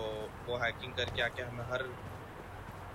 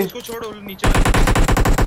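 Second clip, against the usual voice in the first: loud urgent callout; shouting; 7 semitones higher, 16 dB louder than usual. Real, not acted. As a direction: yell it to the squad. Calling out mid-firefight.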